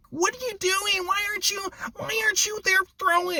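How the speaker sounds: squeaky taunting YouTuber; mocking Rob Stats Guerrero. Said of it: "who's that guy, Rob Stats Guerrero?".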